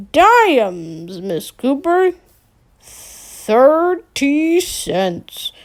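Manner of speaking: slow, drawn-out game show announcer; hammy radio host. Acted. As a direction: stretch it out like a prize reveal. Fake radio host asks question.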